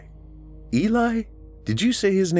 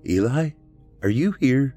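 tentative, higher-pitched question; nervous; 8 semitones higher than usual. A narrator's reading. Becca addresses the ghost by the wall, nervous and high. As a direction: call out uncertainly to someone unseen. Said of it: "She nervously said".

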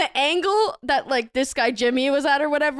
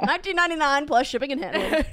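infomercial; faster than usual. Pretend. Mock ad price disclaimer.

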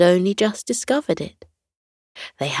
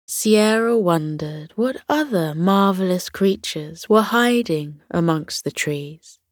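plain reading voice

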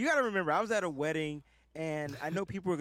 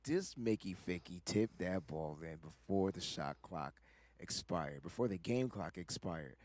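lower, slow mock voice; mock-grave lower voice reading off the ruling; mock disbelief voice; 11 semitones lower, 7 dB quieter, slower than usual. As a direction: drop low and drag it out in disbelief. Quoting own reaction to replay.

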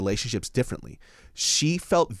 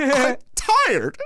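high, louder mock whining; exhausted whine; 14 semitones higher, 5 dB louder than usual. Overworked boyfriend begging off, whining. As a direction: wail it like a worn-out kid. Comic complaint about her demands.

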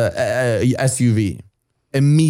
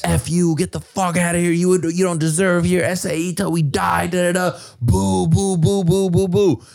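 mimicking jeering crowd; 5 semitones higher than usual. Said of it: "just screaming".

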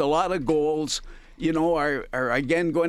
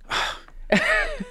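exasperated sigh. Real, not acted. Frustrated pause before explaining again.

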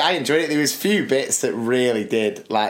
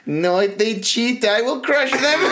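Borat impression; 10 semitones higher than usual. Borat threatening cheaters, mock accent laughing. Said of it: "And he was going".

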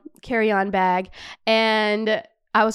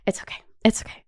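breathless whispered it's okay; faster than usual. Real, not acted. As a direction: console yourself under your breath. Whispered self-consolation.

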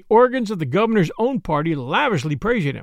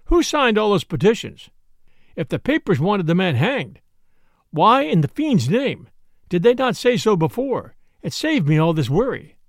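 ordinary delivery

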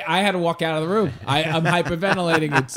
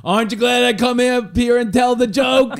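put-on high, loud kid punchline; a kid delivering his punchline proudly; gleeful kid; 11 semitones higher, 7 dB louder than usual. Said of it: "He's like".

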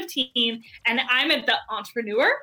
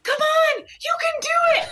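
urging a heroine on from a theater seat, yelling; cheering yell. Retold or imitated. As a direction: shout encouragement at the movie hero. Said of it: "I yell in the movie theater at the screen".

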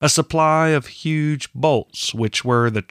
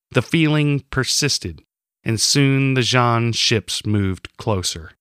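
ordinary delivery

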